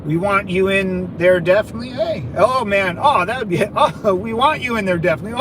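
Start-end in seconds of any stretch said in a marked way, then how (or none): none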